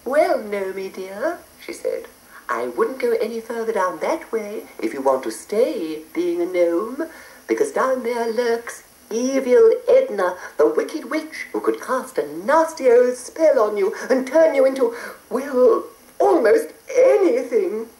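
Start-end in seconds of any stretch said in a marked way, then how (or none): 0.1-1.4 high, slow fond fairy; sweetly fond. Acted. Fairy warms to gnome. 2.5-17.9 high warning fairy; cautious fairy warning. Acted. Fairy warns gnome.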